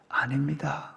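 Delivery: barely voiced, breathy no through tears; whispered denial. A narrator's reading. Moses answering God through tears, whispered. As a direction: whisper it, holding back sobs.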